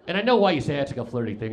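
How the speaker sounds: normal talking voice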